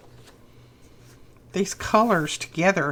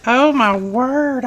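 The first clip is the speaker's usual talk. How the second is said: slow, louder, higher gasp of delight; thrilled exclaim; 5 semitones higher, 6 dB louder, slower than usual. Real, not acted. Vlogger thrilled by the vintage letters, drawn-out exclaim. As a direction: draw it out, overjoyed.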